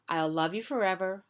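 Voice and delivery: ordinary delivery, nothing marked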